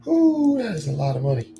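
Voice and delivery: ooh squeaked very high, then a gasp at the price; a shrill jump at the bill; real sticker shock at the cost. Real, not acted.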